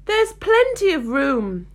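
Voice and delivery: indignant. A narrator's reading. Alice answering the crowded tea party, indignant. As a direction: protest with slow, pointed indignation. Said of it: "said Alice indignantly".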